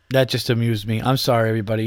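usual speaking voice

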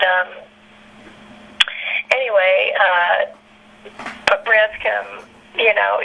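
normal talking voice

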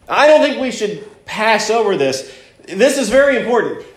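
ordinary talk, nothing marked

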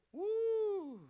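loud, high "woo" of delight; preacher's whoop of praise; high joyful whoop. Real, not acted. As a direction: let out a happy whoop. Preacher's exclamation mid-sermon.

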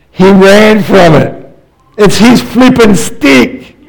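high, slow, disbelieving exclamation; incredulous. Acted. Preacher mocking Moses running from his stick. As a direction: marvel at how silly it is.

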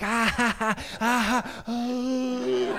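high, taunting ha-ha from the brothers; mocking laughter. Older brothers taunting from outside, mocking laughter. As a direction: jeer in a high sing-song laugh. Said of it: "They were like".

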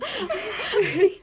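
a sudden high burst of laughter; a loud laugh breaking into the chat. Real, not acted. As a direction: crack up out loud.

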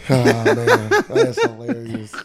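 slow words squeezed through laughter; laughing. Real, not acted. Host amused by friend's Nick joke, laughing. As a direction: giggle your way through it.

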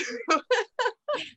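a bright, high burst of laughter. Real, not acted. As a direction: laugh along at the joke. Laughing at fat lip joke.

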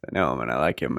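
low, gruff giant voice; Hagrid voice. Retold or imitated. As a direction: rumble it like a big gentle giant. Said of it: "I said it in Hagrid's voice".

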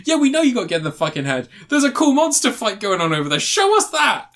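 high, rising comic rant; exasperated mock complaint. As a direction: build to a squeaky, indignant demand. Exasperated viewer's mock reply.

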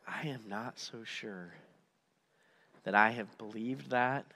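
low, soft, halting admission; quiet doubt. Retold or imitated. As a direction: imitate someone privately admitting doubt. Voicing a listener's inner doubt.

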